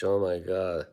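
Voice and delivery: ordinary delivery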